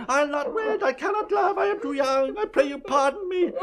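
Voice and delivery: high, mocking whine; whining. Father mocking his daughter's refusals, whining imitation. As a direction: mimic a whining girl in falsetto. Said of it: "a whining mammet".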